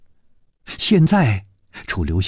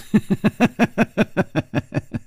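breathy falling cackle; a cold laugh that tumbles down; mocking laugh; 8 semitones higher than usual. Gong Nanyan laughs scornfully at the dying woman. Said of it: "她不停地笑".

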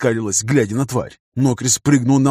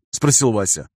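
ordinary delivery

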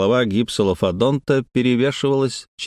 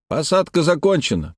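a restrained narrated shout; calling out; 7 semitones higher than usual. A narrator's reading. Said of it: "прокричал Хардинг". (выкрик высоким голосом, без громкости)

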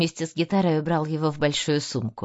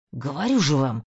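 through gritted teeth; 4 semitones lower than usual. A narrator's reading. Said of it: "процедил музыкант сквозь зубы".

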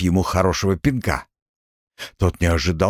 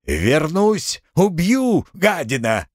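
angry yelling; 7 semitones higher, slower than usual. A narrator's reading. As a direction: scream threats while running off. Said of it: "с воплем".

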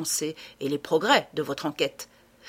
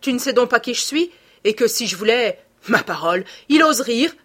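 loud, high indignant outburst; loud, incredulous fury; outraged rant; 4 semitones higher, 7 dB louder than usual. A narrator's reading. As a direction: rage at being laughed at. Lupin rages at mocking youth.

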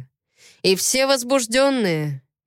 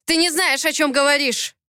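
high-pitched angry rebuke; angry bark; 7 semitones higher than usual. A narrator's reading. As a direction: angrily shut the other person up. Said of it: "рявкнул Кипер". (сердитая отповедь)

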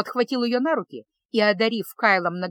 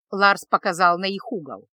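ordinary delivery, nothing marked

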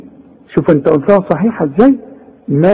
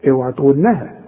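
usual speaking voice

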